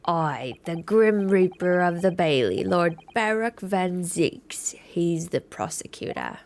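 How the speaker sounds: put-on slow, ominous client in a wandering accent; McGilded. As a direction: intone it slowly with a put-on accent. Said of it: "I keep changing his accent".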